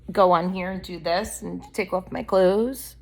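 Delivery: ordinary talk, nothing marked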